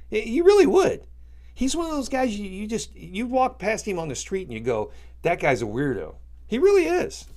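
riled up, squeaking with indignation; fired up. Real, not acted. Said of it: "I got all fired up about that".

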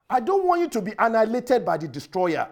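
ordinary delivery

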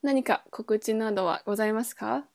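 usual speaking voice

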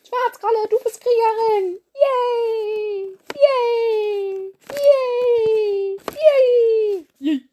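high, loud squealing yays; squealing cheer. Acted. Cheering the new warrior Schwarzkralle, high squeals. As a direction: cheer wildly like a little kid.

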